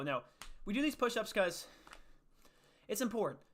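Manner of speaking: normal talking voice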